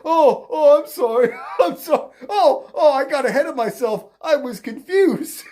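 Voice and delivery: flustered imitation broken by laughter; laughing mock apology. Mocking the candidate's apology for confusion, laughing.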